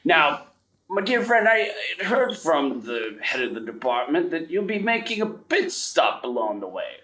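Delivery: put-on old headmaster. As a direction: greet him like a grand old headmaster. GM voicing the headmaster to Hugo.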